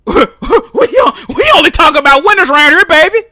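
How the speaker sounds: high, loud mocking taunt; shouted high gloating jeer; mock gloater. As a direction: gloat like a smug fan at a fired man.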